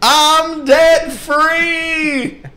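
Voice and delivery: high, loud Southern caller, building to a drawn-out yell; gushing Southern testimony ending in a slow holler. Retold or imitated. Imitating Southern caller thanking radio host, building to yell. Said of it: "I don't know why they're all Southern".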